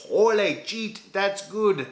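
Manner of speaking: high, slow, hammed-up Mexican accent; a jokey Mexican accent to sell the beer's name; mock Mexican accent. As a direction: ham up a Mexican accent on the slogan. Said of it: "I'm sorry if that's an offensive, uh, accent".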